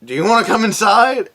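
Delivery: sing-song sarcastic invite; mock invitation. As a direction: sweetly taunt the officer. Naked at door, sarcastic invite.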